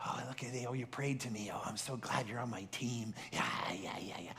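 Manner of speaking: put-on soft, hushed doddering old uncle; mock delight, trailing into soft yeahs; excited daft old uncle. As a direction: play a feeble uncle quietly thrilled by attention. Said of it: "he gets all excited".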